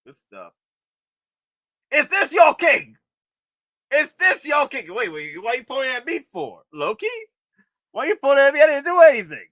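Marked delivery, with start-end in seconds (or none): 1.9-4.9 high, loud mocking challenge; mock Loki taunt. Streamer playing out Loki's taunt, high and loud. Voicing a figure, movie line. 7.9-9.5 high, loud mock indignation; mock indignant protest. Streamer pretending the figure accuses him, high mock outrage.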